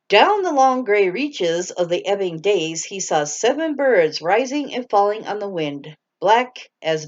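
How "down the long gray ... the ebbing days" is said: ordinary delivery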